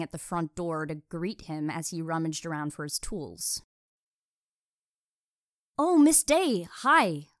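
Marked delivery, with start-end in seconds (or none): 5.8-7.3 higher, louder hail from a distance; high, loud called-out greeting; calling out. A narrator's reading. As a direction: call a cheery hello across the driveway. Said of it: "he called from the driveway".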